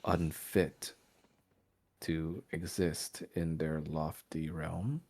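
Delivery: brooding vampire lord. Acted. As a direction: lay out the old grievance slowly, weighing each word. GM voices the vampire lord's speech.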